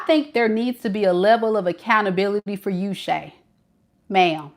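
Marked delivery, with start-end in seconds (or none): none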